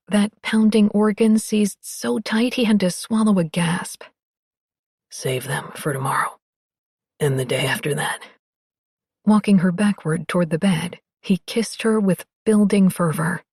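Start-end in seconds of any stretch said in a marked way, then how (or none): 5.1-8.2 low and soft, loving; tender low voice. Acted. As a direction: murmur it softly, lovingly.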